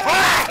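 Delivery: sharp high cry of pain; pained yelp. Real, not acted. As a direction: yelp as if shot. Hit in firefight.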